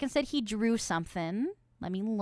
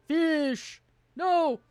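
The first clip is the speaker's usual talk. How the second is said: high, loud cries; frustrated shout; 7 semitones higher, 7 dB louder, much slower than usual. Real, not acted. Streamer loses patience while fishing, frustrated shout. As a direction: yell for the fish. Calls out for the fish.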